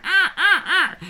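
high squawking crow imitation with a laugh; crow caws. Retelling the crow in the trailer, laughing high squawks. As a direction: caw like a crow, laughing through it. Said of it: "the crow's going".

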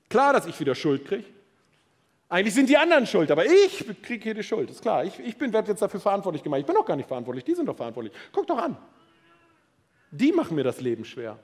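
mock the one who blames everyone else; indignant martyr, hammed-up; whiny victim. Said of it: "die Person, die sagt so: Ich bin ein Opfer".

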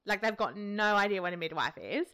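usual speaking voice

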